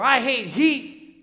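loud, drawn-out grumble; mock complaint. Voicing Christians' complaint about being sent.

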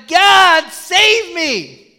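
high, loud plea for rescue; desperate cry to God. Retold or imitated. Man stranded on roof begs God to save him, loud desperate cry.

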